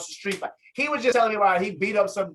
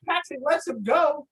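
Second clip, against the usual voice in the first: ordinary talk, nothing marked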